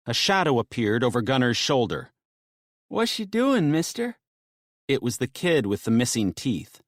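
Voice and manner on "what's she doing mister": put-on high child's voice; young boy asking a stranger; curious kid. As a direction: ask like a small boy. Kid with missing teeth speaks.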